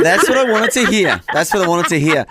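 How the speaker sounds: laughing through the words; delighted, laughing reply. Real, not acted. Host delighted by the answer.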